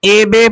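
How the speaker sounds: اے بے both with "ay"; majhul vowel. Shown as a demonstration. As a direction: demonstrate the faulty "ay, bay" for اے بے. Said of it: "تو یہ مجہول ہو جائے گا".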